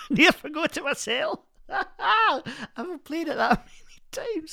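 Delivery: high, giggling through the words; laughing. Real, not acted. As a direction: laugh at yourself as you talk. Laughs at forgetting own number.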